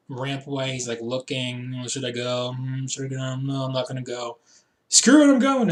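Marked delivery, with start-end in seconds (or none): 1.8-4.4 mildly mock indecision; hesitant wrestler. Wrestler debating with himself on the ramp whether to join, hesitant. 4.9-5.7 sudden mock resolve. Wrestler abruptly deciding to charge into the brawl, impulsive shout.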